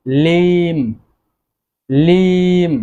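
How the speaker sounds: লিম said slowly and clearly, with the b silent; careful pronunciation. Shown as a demonstration. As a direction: model the word লিম for the class. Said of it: "বি এর উচ্চারণ কিন্তু হচ্ছে না".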